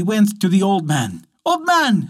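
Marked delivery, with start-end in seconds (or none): 1.4-2.1 high, calling out to her husband; high excited call; excited cry. A narrator's reading. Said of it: "she cried".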